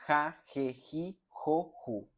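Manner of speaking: "Ja, je, ji, jo, ju" with a throaty J, one pause per syllable; teacher's syllable drill. Shown as a demonstration. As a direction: spell out the J syllables slowly. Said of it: "la siguiente pronunciación".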